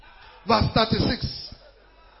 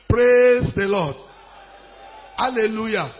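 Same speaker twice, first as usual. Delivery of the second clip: louder, drawn-out PRAISE; rousing call; 7 dB louder than usual. Real, not acted. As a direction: hold Praise long and project it. Repeats call to rouse congregation.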